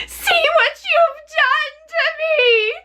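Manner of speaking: high, slow, shrieking witch voice; a witch shrieking in horror as she melts; screaming witch. Acted. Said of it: "she screamed".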